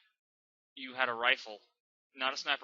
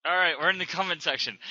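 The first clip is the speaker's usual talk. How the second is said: usual speaking voice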